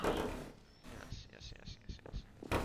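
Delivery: hushed, repeated whisper; whispered yeses. Acted. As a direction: whisper it to yourself. Character gloats to himself.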